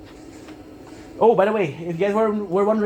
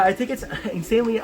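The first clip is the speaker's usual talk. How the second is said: ordinary delivery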